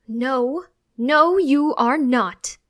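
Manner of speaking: very loud, emphatic denial; arguing back. A narrator's reading. Mother contradicting her child in a quarrel, loud and firm.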